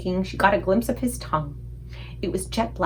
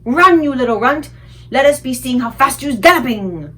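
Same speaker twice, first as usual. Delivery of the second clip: loud, higher giant jeer; shouted; 5 semitones higher, 8 dB louder than usual. A narrator's reading. Giants jeering as the BFG flees, shouting. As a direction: kick him off with a yell.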